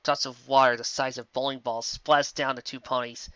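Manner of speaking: ordinary delivery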